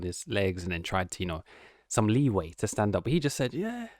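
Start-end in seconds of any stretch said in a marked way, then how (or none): 3.5-3.9 lightly mocked British accent, high and soft. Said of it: "That British accent".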